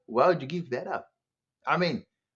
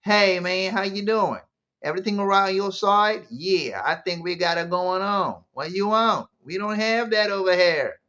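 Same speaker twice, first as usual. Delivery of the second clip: exaggerated casual American accent, pitched up and louder; mock American accent; 4 semitones higher, 3 dB louder than usual. Telling Afrikaners how they'll talk after America, mock American accent. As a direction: parody a returning Afrikaner's new American accent. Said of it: "you're gonna now speak like this".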